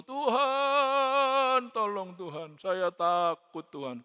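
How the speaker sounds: drawn-out calling opening, then easing; retold cry to God. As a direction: call out "Lord" long and high, then plead. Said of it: "saya berseru".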